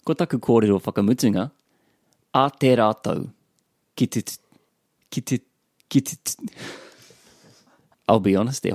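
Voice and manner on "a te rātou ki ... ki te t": halting, broken-off attempts; stuck on the first t, stopping short; struggling to say it. Real, not acted. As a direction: stumble on "tutuki" and restart. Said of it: "I'm really struggling with this one".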